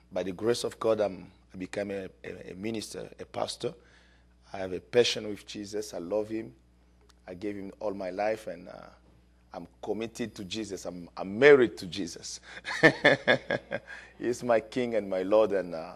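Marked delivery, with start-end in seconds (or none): none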